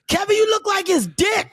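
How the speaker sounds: high-pitched jeer; mock heckler holler. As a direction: shout it down from the stands. Fans heckling him on the field.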